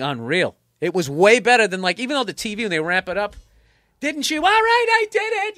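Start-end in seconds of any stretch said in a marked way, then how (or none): 4.0-4.4 high-pitched TV interrogator accusation; mock courtroom yell. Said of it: "you just yell at somebody". 4.4-5.6 high, loud mock breakdown confession; hammed-up TV confession. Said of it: "then they confess".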